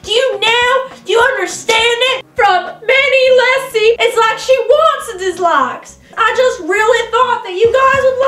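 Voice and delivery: high, loud indignant outburst; indignant rant. Real, not acted. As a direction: vent about the dislike count. Ranting about dislikes.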